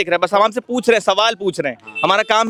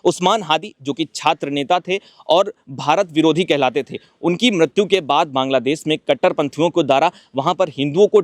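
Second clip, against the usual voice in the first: lower, steady reporter's narration; measured field-report delivery; news report to camera; 4 semitones lower than usual. Acted. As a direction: present the story straight to the camera.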